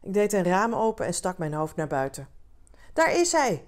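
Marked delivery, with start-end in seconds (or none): 2.9-3.6 high, raised call of excited fans; roepende menigte. A narrator's reading. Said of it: "riepen ze". (hoog en luid uitroepen)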